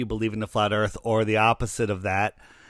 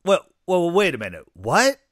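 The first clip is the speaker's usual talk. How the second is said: surprised; 7 semitones higher, 3 dB louder than usual. Retold or imitated. Man surprised by the shirt.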